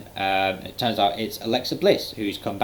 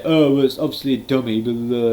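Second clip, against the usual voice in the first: mocking smarky fan; 2 semitones higher, 5 dB louder than usual. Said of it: "they go".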